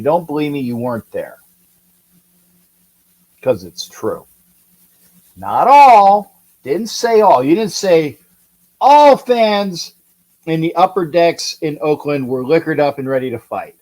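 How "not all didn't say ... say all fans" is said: ALL punched high and loud; stress on ALL. Real, not acted. Correcting listeners on what was said, stress on ALL. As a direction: hit "all" hard, pitched up.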